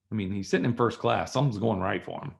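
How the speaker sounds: ordinary talk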